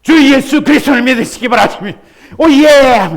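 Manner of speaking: loud, high, fast exuberant preaching; lively gospel preacher shouting to an excited church; fiery preacher. Retold or imitated. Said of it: "분위기가 완전히 달라져".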